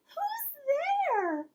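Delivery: very high squeaky call; a startled little mouse calling into the dark; mouse cries out. Acted. Mouse hears someone at the cave in a squeaky cry. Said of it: "Mouse cries".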